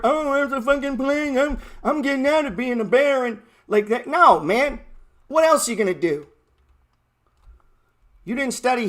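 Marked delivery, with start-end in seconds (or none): 0.0-3.4 higher put-on voice of a whiny baron; mock medieval baron. Mocking scared landlords as a medieval baron. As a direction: ham up a fussy nobleman fleeing the plague. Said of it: "You think that's how they talked in medieval Europe?".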